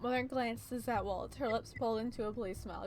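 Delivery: usual speaking voice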